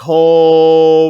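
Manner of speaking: long, loud held "oh"; excited anticipation. Retold or imitated. Viewer seeing Art in the driver's seat, excited. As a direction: stretch it out loudly, relishing what's coming.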